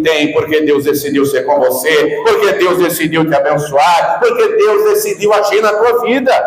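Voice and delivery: mounting exhortation, peaking on the last phrase; building preaching fervour. Real, not acted. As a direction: preach it harder with every repeat.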